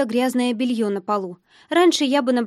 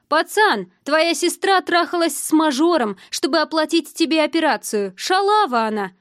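raised, higher, scornful voice; loud announcement; 5 semitones higher, 4 dB louder than usual. A narrator's reading. Drunk man exposing the sister to her brother, loud. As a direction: call it out loudly to shame her. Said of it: "громко произнёс".

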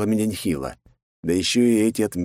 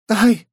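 high loud yelp; sharp cry of pain; 7 dB louder than usual. A narrator's reading. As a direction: yelp from a jab in the wound. Stabbed wound.

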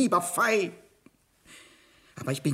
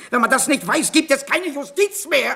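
higher and louder, slowing into an outburst; shouting; 10 semitones higher, 6 dB louder than usual. Acted. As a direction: blurt it out in a panicked shout. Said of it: "Ich würde nicht schreien".

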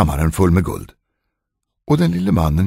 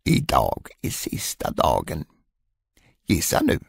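nasty grin; 3 semitones lower, 6 dB quieter than usual. Acted. Dwarf taunts the queen on the last day, lightly gloating. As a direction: savour the threat quietly. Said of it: "med ett elakt grin".

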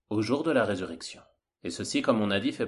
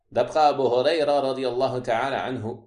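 ordinary talk

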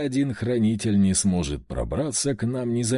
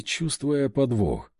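ordinary delivery

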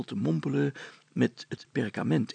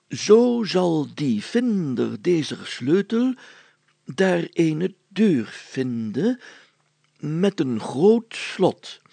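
slow ceremonious archaic reading; solemn reading; 5 semitones higher, 7 dB louder, slower than usual. A narrator's reading. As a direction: declaim the ancient text gravely. Mayor reads old document. (plechtig voorlezen)

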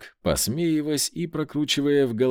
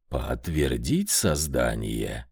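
low, slow, softened stony voice; grinding stone statue; 11 semitones lower, slower than usual. Acted. Statue golem asks confirmation.

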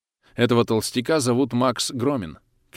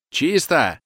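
higher, louder call of clear; shout; 7 semitones higher, 5 dB louder than usual. Acted. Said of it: "кричит Истомин".

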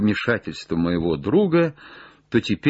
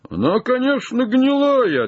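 very high, raised reply; calling down; 4 dB louder than usual. Acted. Jupiter reports on the rotten branch, high call. Answering from the treetop.

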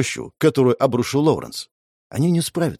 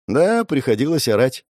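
usual speaking voice

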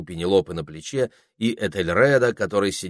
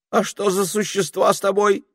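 wary ermine; 13 semitones higher, 5 dB louder than usual. A narrator's reading. Ermine asks warily.

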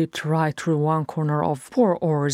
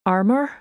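higher, louder questioning rise; wondering; 6 semitones higher, 4 dB louder than usual. A narrator's reading. Farron guessing what crashed behind the door, wondering. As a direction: guess aloud with a rising, puzzled lilt. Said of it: "Farron wondered".

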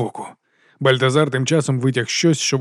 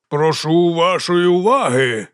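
slow, raised, drawn-out announcement; calling for attention; 4 semitones higher, slower than usual. A narrator's reading. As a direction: address a whole crowd grandly. Speaker calls crowd to attention.

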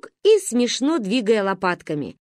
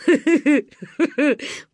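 laughing; 7 semitones higher than usual. A narrator's reading. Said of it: "Покатился со смеху дядя Миша".

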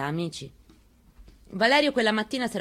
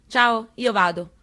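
called out higher, louder and slow; son's cheerful goodbye; 4 semitones higher, 7 dB louder, slower than usual. Retold or imitated. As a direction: call out goodbye like a teen heading out the door. Son calling goodbye leaving house. (saluto del figlio, più alto, forte e lento)

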